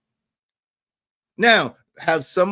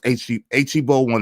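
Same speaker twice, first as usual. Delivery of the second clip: usual speaking voice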